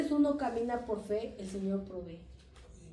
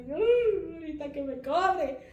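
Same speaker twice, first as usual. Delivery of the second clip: mock gleeful dread; 6 semitones higher, 5 dB louder, slower than usual. Acting reaction to generous plate.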